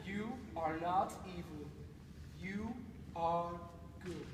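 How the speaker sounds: very soft, low coaxing; tender flattery. Acted. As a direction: comfort and praise a frightened creature. Soothes creature with praise.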